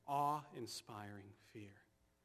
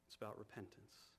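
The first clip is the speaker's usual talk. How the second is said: much softer, hushed repeat; quiet emphasis; 3 semitones lower, 12 dB quieter than usual. Shown as a demonstration. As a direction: let it sink in softly. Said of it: "So, church".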